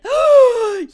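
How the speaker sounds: high, loud theatrical gasp; hammed-up sharp intake of breath; mock gasp. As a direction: gasp as a scandalised lady. Retold shocked heroine gasp.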